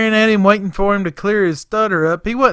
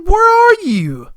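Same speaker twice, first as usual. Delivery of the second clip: high, drawn-out loud holler; calling out; 13 semitones higher, 7 dB louder, slower than usual. Retold or imitated. Boss searches for the vanished helper, long loud holler.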